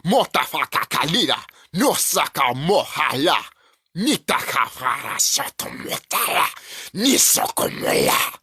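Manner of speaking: invented dragon tongue, chanted slow and otherworldly; dragon language. Pretend. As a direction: speak as a dragon would to its kin. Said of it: "talking like dragons".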